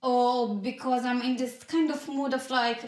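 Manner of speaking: normal talking voice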